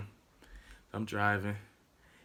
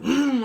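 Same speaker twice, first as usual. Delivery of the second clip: high, loud revving hum; imitated engine roar; 12 semitones higher, 7 dB louder than usual. Driver showing how he took off, revving noise. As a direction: rev it like a car taking off.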